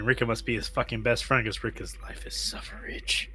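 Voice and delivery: usual speaking voice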